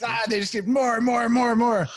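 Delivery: put-on high, loud, insistent toddler demand; two-year-old. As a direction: imitate a small child clamoring on repeat. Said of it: "do I sound like a two-year-old".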